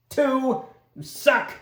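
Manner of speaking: loud, high disbelieving jeer; mock outrage. Real, not acted. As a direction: tease the character for the bad roll. Disappointed by a low roll.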